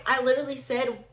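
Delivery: ordinary delivery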